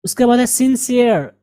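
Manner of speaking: ordinary talk, nothing marked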